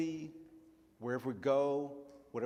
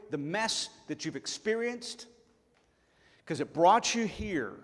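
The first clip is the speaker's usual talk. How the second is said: louder, rising preacher's emphasis; preaching at full force; 5 semitones higher, 4 dB louder than usual. Acted. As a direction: drive the point home to the congregation. Preacher pressing the point.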